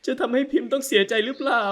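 high, crying question; tearful question. Acted. Wife asking if she'll be hurt, crying. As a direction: let the voice break into tears.

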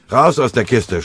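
loud, high command to jump out; shouting. Acted. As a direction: bark the order over gunfire. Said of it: "schrie er". (laut geschrien)

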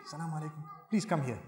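soft, polite calm request; gentle request. Shown as a demonstration. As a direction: ask her kindly and quietly. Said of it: "you need to say".

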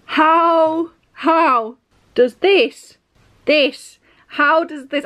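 spluttering at a ridiculous reveal; exasperated outrage. Real, not acted. Reader sputtering at the masked man, loud and exasperated.

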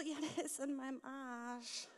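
quiet giggling confession; embarrassed confession. Pretend. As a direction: mumble it in shame. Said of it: "ich dann so".

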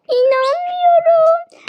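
high, slow gasp of disbelief; can't believe it, voice shooting up; shocked disbelief. Real, not acted. Discovers the old LPS prints.

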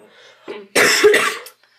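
loud harsh coughing fit. Real, not acted. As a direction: choke and cough hard. Cinnamon makes her cough.

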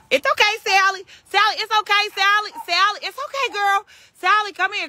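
high sing-song coaxing; high coaxing call. Real, not acted. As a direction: call her gently but up high so she'll come. Coaxing a frightened pig.

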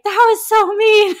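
high, loud wounded whine; mock-wail it like a hurt child; hurt protest. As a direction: Nora whining to her guide, high and loud. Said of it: "She's like".

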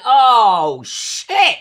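loud, high shocked yelp; retold jolt of surprise; startled. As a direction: blurt it out in shock. Her startled reaction retold.

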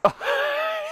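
sudden high-pitched laugh; high burst of laughter. Real, not acted. Laughs at ball near houses.

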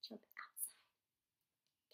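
slow, hushed whisper. Pretend. Hinting to a child what the frog might do, whispered. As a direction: whisper it like a secret hint. Suggesting the frog's secret idea.

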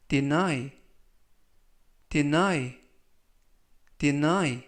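careful "deny" with a falling end; slow model reading. Shown as a demonstration. Teacher reading a word for students to repeat, slowly. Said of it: "천천히 세 번씩 읽어드리고요".

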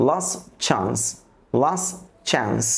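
Last said as las, right into chance; no t at the end of Last; last chance without t. Shown as a demonstration. Teacher demonstrating elision for learners, t left out. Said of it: "আমরা টি এবং ডির উচ্চারণ করব না".

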